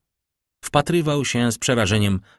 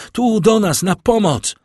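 much higher and louder, desperate shout; cry for help; 13 semitones higher, 6 dB louder than usual. A narrator's reading. Valentine screams for help as the servant collapses.

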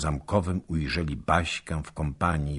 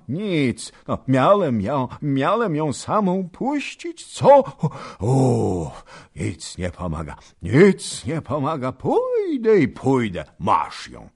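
put-on exasperated old man; 8 semitones higher, 4 dB louder than usual. Old man exasperated, excusing himself.

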